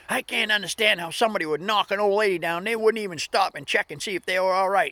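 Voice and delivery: high, loud indignant rant; mad older lady. Retold or imitated. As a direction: rant as the old lady getting madder. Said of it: "the madder she got".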